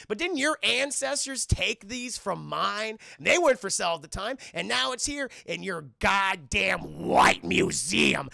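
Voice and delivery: loud, drawn-out retold confrontation; indignant accusation. Killmonger confronting the British exhibitor, loud indignant accusation. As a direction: press each word home as an accusation.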